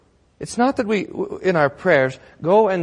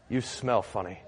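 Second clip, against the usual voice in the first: low, very soft deadpan remark; wife breaking silence; 5 semitones lower, 11 dB quieter than usual. Acted. Wife to husband after silent treatment, quiet jab. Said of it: "the wife turns to the husband and says".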